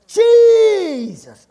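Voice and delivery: loud, high, drawn-out cry for help; long pleading holler that falls away at the end; desperate shout. Acted. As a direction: shout after someone far ahead, holding the vowel. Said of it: "She shouted".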